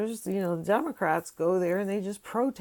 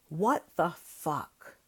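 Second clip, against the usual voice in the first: disgusted disbelief; 2 semitones lower, much slower than usual. Real, not acted. Weary vlogger groans over protesters called terrorists.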